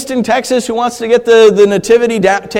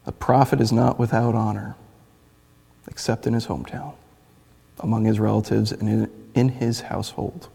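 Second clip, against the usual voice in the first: scripture reading; 9 semitones lower, 9 dB quieter than usual. Acted. A preacher reading the verse to the church, soft. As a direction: read it reverently and softly.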